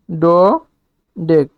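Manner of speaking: ডোডেক as a long "do", a pause, then "dek"; syllable by syllable. Shown as a demonstration. Teacher naming the twelve-carbon prefix, ডোডেক in separated syllables. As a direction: split ডোডেক widely into two slow syllables. Teacher sounds out name while writing.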